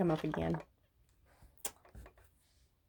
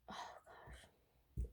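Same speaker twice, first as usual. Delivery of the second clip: a breathless, voiceless oh gosh; choked-up whispered aside; emotional whisper; 22 dB quieter than usual. Real, not acted. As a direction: whisper it, overcome with feeling. Moved to tears mid-reading.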